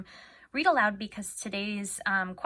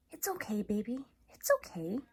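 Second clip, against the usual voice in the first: put-on girl comforting the elephant after the fight; soothing; 3 semitones higher, 5 dB quieter than usual. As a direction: gently reassure the scared elephant.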